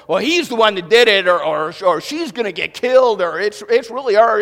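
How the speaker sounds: hammed-up know-it-all; know-it-all movie talker. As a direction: play the moviegoer who knows it all. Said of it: "they're going".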